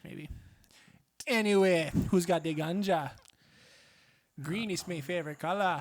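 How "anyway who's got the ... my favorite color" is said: hammed-up Jamaican, stretched out; bad Jamaican accent. Shown as a demonstration. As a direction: lean into a terrible Jamaican accent. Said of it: "still shitty Jamaican".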